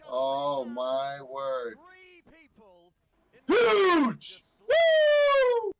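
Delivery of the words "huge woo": high, loud whoops of joy; hyped victory yells; pumped-up shouts. Real, not acted. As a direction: cheer the big play at full voice. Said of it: "That was me pumped up in a good mood".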